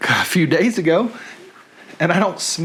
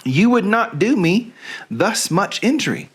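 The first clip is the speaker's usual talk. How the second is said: put-on Southern accent. Vlogger reads Helena's speech from Shakespeare, in a light Southern accent. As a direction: read the Bard like a Southern belle, the accent held throughout. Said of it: "Reading Things in a Southern Accent".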